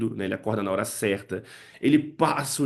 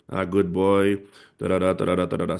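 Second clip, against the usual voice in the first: lower, toneless singsong-free reading; reading like a newspaper; 4 semitones lower than usual. Shown as a demonstration. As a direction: read it off with no expression. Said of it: "Você não vai ler".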